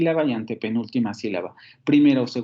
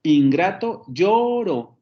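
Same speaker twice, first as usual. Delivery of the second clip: "Ingrato, lloro" with LLO held high, then falling; deliberate scanning, "ingrato, LLO-ro" high on LLO; stress on LLO; 5 semitones higher, 3 dB louder, much slower than usual. Shown as a demonstration. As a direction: punch and stretch LLO in "lloro". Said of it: "el acento en la antepenúltima sílaba".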